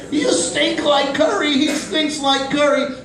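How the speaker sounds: high, slow playground taunt; sing-song childhood teasing, pitched high; mocking bullies.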